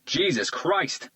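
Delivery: slow, high shocked shout. A narrator's reading. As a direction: cry out in shock. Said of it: "the S T U leader shouted".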